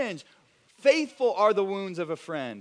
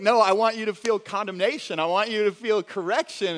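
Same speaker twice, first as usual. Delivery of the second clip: louder, pressing mock protest; mock insistence; 3 semitones higher, 8 dB louder than usual. Pastor imagines protesting to a grateful friend, louder. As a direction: insist you meant to correct them. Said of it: "it's like".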